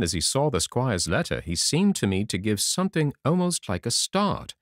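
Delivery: ordinary delivery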